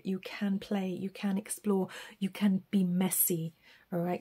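whisper. Real, not acted. Gentle encouragement, whispered aside.

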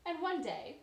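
plain reading voice

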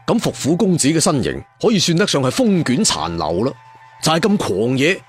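usual speaking voice

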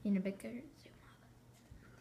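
hushed whisper, slowed. Real, not acted. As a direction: whisper while zooming in. Whispered aside while filming.

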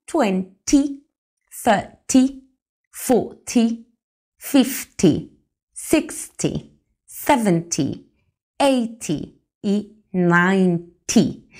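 slow count with a short, dry "ty" on "twenty" to "ninety"; teacher drilling the round tens. Shown as a demonstration. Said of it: "Es un T seco, corto, rápido".

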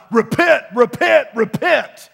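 loud mock hellfire shouts of repent; imitating a screaming preacher; preacher's scream. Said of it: "We hear preachers scream all the time".

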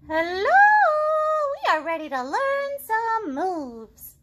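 high, very slow, chirpy greeting; bright and bouncy squirrel hello; eager squirrels. Acted. Squirrels call to Piggy, eager to learn moves.

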